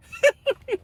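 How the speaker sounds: a high airy giggle; high mock laugh. Mom laughs it off.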